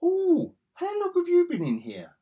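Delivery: high, loud mocking impression of the line; mocking Nick Fury. Reviewer mocks Fury's line to Rhodey, high and loud. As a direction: parrot the clumsy line in a silly high voice. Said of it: "to Rhodey and goes".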